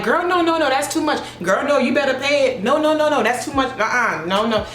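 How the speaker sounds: insistent refusal. Retold or imitated. Stylist refusing her bestie's overpayment, high insistent voice. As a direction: protest it, laughing at her.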